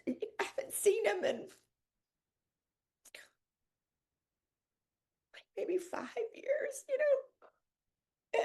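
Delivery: real tears, voice breaks into a whisper; crying whisper. Real, not acted. Host recalling her friends, crying in a whisper. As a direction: choke up on the words, barely voiced. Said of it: "I've cried it all off".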